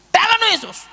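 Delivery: loud, high invocation; acted faith-healer's shout; prayer command. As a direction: cry it out with sudden force. Acts out healing prayer while grabbing.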